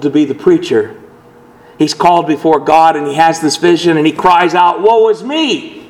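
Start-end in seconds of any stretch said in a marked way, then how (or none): 4.8-5.8 anguished cry. Acted. Isaiah cries out.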